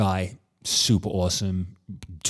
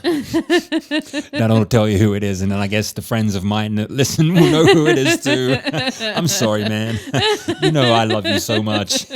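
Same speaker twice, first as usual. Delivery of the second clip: laughing; 3 semitones higher, 5 dB louder than usual. Real, not acted. Laughing apology.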